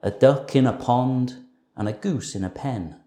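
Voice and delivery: plain reading voice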